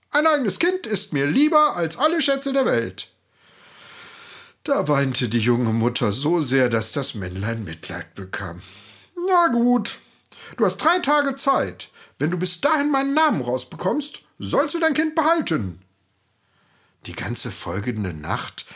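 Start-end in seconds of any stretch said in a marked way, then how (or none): 0.1-3.1 high-pitched little man refusing firmly; entschiedenes Männlein. A narrator's reading. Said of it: "das Männlein verneinte entschieden". 9.1-15.8 high little goblin setting terms; einlenkendes Männlein. A narrator's reading. Männlein gewährt drei Tage Frist.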